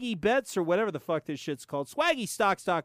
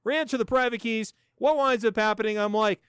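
ordinary talk

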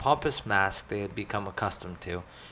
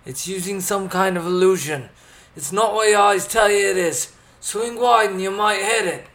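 loud, high battle shout; urgent shouted warning; 11 semitones higher, 11 dB louder than usual. A narrator's reading. Warning companions mid-fight with the creature, urgent shout. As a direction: call out over a fight.

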